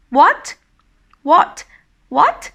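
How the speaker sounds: high, loud, rising "What?"; surprised wonder. Acted. Reply to best friend's girlfriend news, surprised and wondering. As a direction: shoot "What?" upward in disbelief. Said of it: "Surprised and also, like, wonder".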